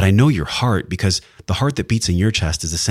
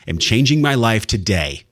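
emphatic declaration; 4 semitones higher than usual. Acted. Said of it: "You declare".

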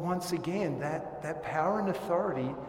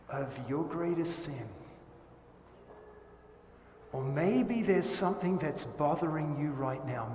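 usual speaking voice

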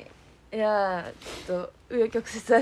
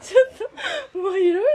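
laughing; 8 semitones higher, 3 dB louder, faster than usual. Real, not acted. Embarrassed laughing confession.

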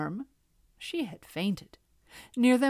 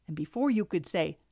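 usual speaking voice

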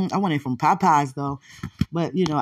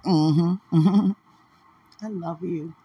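ordinary delivery

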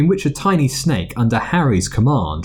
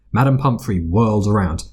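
ordinary delivery, nothing marked

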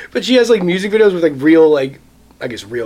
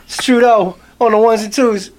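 higher-pitched hype rapper intro; mock rap-track shout-out; Canadian rapper shout-out; 5 semitones higher than usual. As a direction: play a rapper announcing himself on the mic. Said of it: "pretty dope Canadian rapper name".

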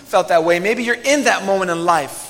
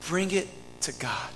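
soft plea; 10 dB quieter than usual. Real, not acted. Pastor pleads with listeners.

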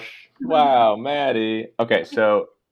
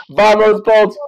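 high loud rallying cry; excited cheer; 12 semitones higher, 10 dB louder, slower than usual. Acted. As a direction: yell it like a fan in the stands. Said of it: "I'm an idiot".

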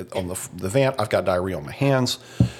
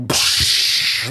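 crash noise. Retold or imitated. Imitating glass breaking.

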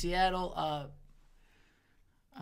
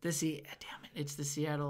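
whispering; 4 semitones lower, 9 dB quieter than usual. Real, not acted. Frustrated muttering while guessing.

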